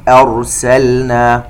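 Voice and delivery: "أرسلنا" slowly, hamza thin and rā full and heavy; thin hamza then heavy rā in "أرسلنا"; light hamza, heavy rā. Shown as a demonstration. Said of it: "الراء تفخم". ("أرسلنا" بهمزة مرققة وراء مفخمة)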